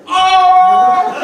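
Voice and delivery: high loud mock crowd oh; crowd oohing. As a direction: react like a hyped crowd. Crowd reacts to diss.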